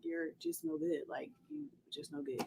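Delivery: very soft, under the breath; muttered disapproval. Retold or imitated. Driver silently judging her giggling friend, muttered disapproval. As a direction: murmur it like a private judgement. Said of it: "I'm just looking at her like".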